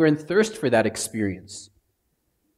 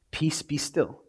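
soft, hushed calm; calm command; 7 dB quieter, slower than usual. Pretend. Jesus calming the storm, softly. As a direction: still the storm gently. The Good Shepherd's calming words.

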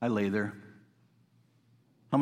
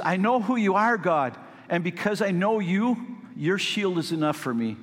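David pouring out his heart to God, pitched high; David imitation; 7 semitones higher than usual. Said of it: "May I do my best David imitation?".

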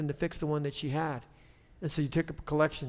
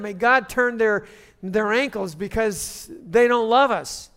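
raised, louder sermon voice; higher, driving pulpit delivery; forceful preaching; 4 semitones higher, 7 dB louder than usual. Real, not acted. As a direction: preach it emphatically to the congregation.

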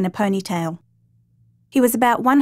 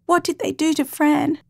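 fearful; 7 semitones higher than usual. Acted. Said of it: "Reem thought fearfully".

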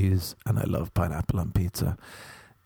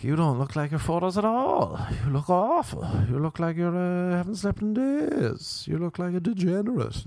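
high, appalled critic voice; high mock insult; 8 semitones higher than usual. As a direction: act appalled, pitch up. People insulting him.

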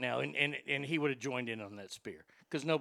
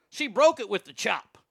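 ordinary delivery

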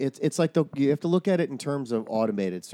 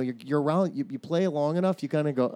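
usual speaking voice

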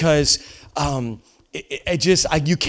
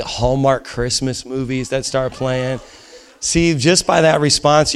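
usual speaking voice

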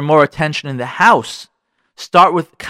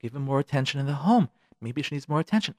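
usual speaking voice

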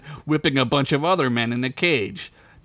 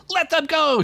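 high heroic yell. Acted. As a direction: burst out and command. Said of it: "she yelled".

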